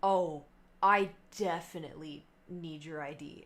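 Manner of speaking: mock-serious carding voice; imitated skeptical bartender. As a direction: play a bartender who doubts her age. Bartender carding her, retold.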